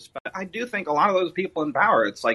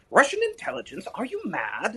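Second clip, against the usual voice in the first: high, incredulous mock Austin Powers; imitating Austin Powers; 7 semitones higher than usual. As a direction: squeal it like a shocked Austin Powers. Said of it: "And he's like".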